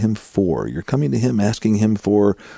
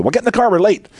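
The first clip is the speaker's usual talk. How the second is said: put-on higher, louder, snappy hurry-up to the kid; impatient parent; 13 semitones higher, 4 dB louder, faster than usual. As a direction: bark it like a rushed, fed-up parent. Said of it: "As opposed to".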